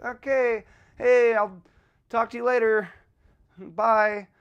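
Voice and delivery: stilted, drawn-out farewell with pauses; awkward goodbye. Acted. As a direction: fumble your way out of a conversation. Acting out an awkward parting.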